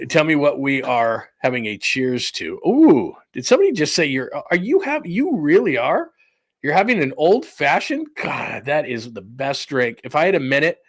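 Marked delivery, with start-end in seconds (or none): 2.7-8.1 excited surprise. Real, not acted. Delighted at a drink choice.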